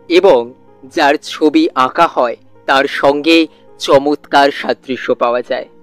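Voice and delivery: ordinary delivery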